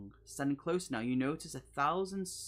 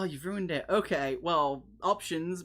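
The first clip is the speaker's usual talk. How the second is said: ordinary talk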